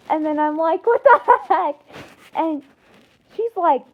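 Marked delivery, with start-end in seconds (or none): 0.9-1.8 high, loud, half-laughing disbelief; startled disbelief. Acted. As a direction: blurt it out, incredulous and amused. Teller's startled reply to warning.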